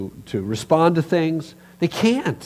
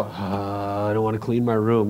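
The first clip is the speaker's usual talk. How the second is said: lower, reluctant whine; whiny kid; 6 semitones lower, 4 dB quieter than usual. Retold or imitated. Kid whining to parent about cleaning room, reluctant. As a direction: drag it out like a sulky kid. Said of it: "And you go".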